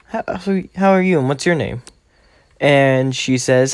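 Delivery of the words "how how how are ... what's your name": lightly voiced young girl, pitched up; a hint of an eager schoolgirl; girly voice. Uraraka voiced, nervous chatter.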